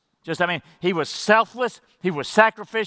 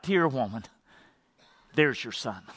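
softly and lower, a loving murmur; tender address; 6 semitones lower, 6 dB quieter than usual. Shown as a demonstration. Jesus speaking to his mother from the cross, softly tender. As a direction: say it gently, like calling her mother. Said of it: "It's a tender term of affection".

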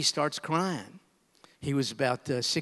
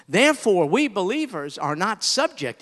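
ordinary talk

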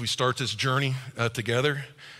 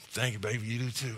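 soft, softer tender thank-you to husband; 5 semitones lower, 9 dB quieter than usual. Retold or imitated. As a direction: answer his compliment gently. Wife answering husband's compliment.

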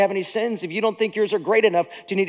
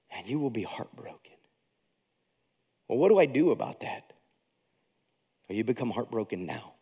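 hushed tender preaching; hushed and sorrowful; 10 semitones lower, 10 dB quieter than usual. Real, not acted. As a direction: let it fall quiet, grieving with them. Quiet heartfelt sermon turn.

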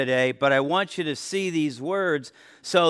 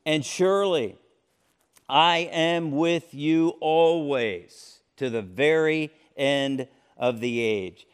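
Bible verse read aloud with weight, a touch louder; scripture reading; 4 dB louder than usual. Acted. Preacher reads the Great Commission to listeners, a touch of proclaiming.

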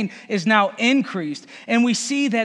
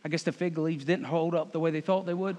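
ordinary delivery

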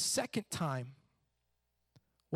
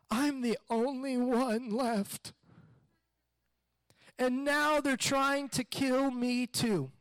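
whiny complaint; 5 semitones higher, slower than usual. Acted. Elijah whining his complaint.

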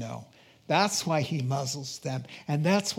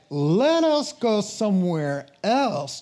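slow, higher, lightly dramatized reply; acted reply of Jesus; 5 semitones higher, 4 dB louder, slower than usual. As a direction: draw it out like a scripture character.

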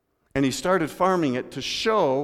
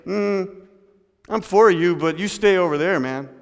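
louder, dismissive "I'm for you" that keeps people at arm's length; mock two-faced support; 4 semitones higher, 7 dB louder than usual. Pastor mocks the retributive attitude, louder and dismissive. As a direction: hold them off while claiming to support them. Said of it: "Retributive justice wants to stay, say".

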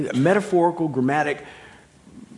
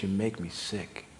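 lower, softer, slow and grave; God's disgust; 7 semitones lower, 9 dB quieter than usual. Acted. Preacher voicing God rejecting the lukewarm, low, quiet and slow. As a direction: let God's revulsion sink in quietly. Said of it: "he says".

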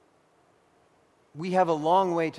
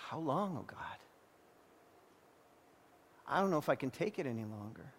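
much softer, worn-out plea; exhausted despair; 9 dB quieter, faster than usual. Acted. Sufferer at the end of strength, quietly despairing to God. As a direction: let the prayer sink to a spent, quiet end.

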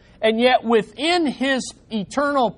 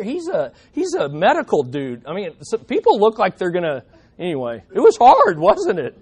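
normal talking voice

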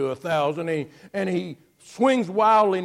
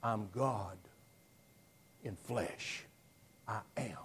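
hushed I am; 8 semitones lower, 17 dB quieter than usual. Retold or imitated. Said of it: "he says".

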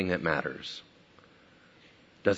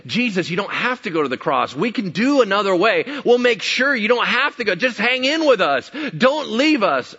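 higher, pressing plea; urgent pleading; 11 semitones higher, 6 dB louder than usual. Retold or imitated. Disciple begging Jesus not to go to the cross, urgent pleading. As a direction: beg him with rising urgency.

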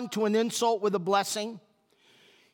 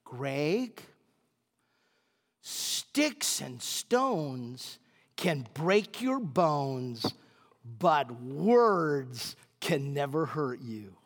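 soft, lower, slow singsong adage; mother's saying; 7 semitones lower, 4 dB quieter, much slower than usual. Retold or imitated. Mother teaching little Greg a saying, soft slow. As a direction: recite it like a patient mother. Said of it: "she said".

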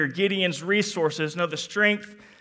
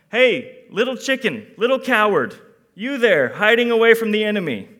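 loud, higher, mocking jeer, speeding up at the end; mock taunt; 5 semitones higher, 11 dB louder than usual. Imagined God mocking hiding Gideon, loud teasing jeer. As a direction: sneer at a coward in fun. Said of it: "God doesn't call him".

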